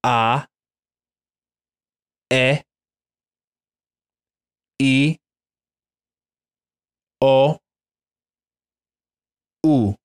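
A, E, I, O, U as pure Spanish vowels, ah eh ee oh oo, each held apart. Shown as a demonstration. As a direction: model each vowel slowly for repeating. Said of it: "repeat after me".

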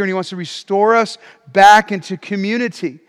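ordinary talk, nothing marked

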